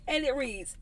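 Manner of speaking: ordinary delivery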